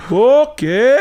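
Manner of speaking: high-pitched, wide-eyed amazed "okay"; easily tricked Scandinavian. Acted. Mocking Scandinavians as easy carny marks, naive amazement. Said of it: "they just seem like".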